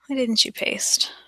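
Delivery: soft unvoiced whisper, slow. Real, not acted. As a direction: whisper it to yourself, puzzled. Whispered aside to the screen.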